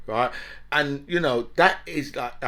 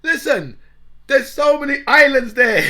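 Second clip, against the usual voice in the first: higher and louder, setting them straight; mock exasperation, building louder; exasperated retort; 8 semitones higher, 7 dB louder than usual. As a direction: push back, fed up. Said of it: "I'm like".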